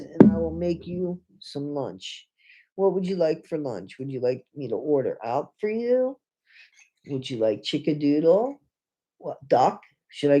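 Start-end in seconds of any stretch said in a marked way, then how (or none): none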